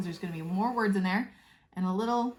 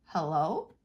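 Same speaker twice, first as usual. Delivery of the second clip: "Hello?" with a rising question tune; wondering where you are, asking hello; 4 semitones lower than usual. Shown as a demonstration. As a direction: ask "Hello?" like you can't find them. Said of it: "He uses question marks for asking questions".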